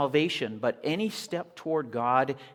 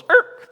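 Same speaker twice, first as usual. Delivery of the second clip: a high, loud comic screech of stopping; sudden braking squeak; 16 dB louder than usual. Retold or imitated. Preacher acting Jesus stopping short, high loud screech. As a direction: squeal like brakes slamming on. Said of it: "Jesus goes".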